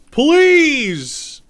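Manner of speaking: over-the-top plea, very high and loud; mock pleading shout. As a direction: beg at the top of your lungs, playing it up. Begging chat not to help.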